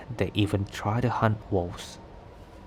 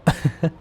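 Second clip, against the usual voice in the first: short, higher, louder laugh; brief amused burst of laughter; knowing chuckle; 7 semitones higher, 5 dB louder than usual. Acted. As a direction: chuckle at the reveal. Amused after revealing wolf identity.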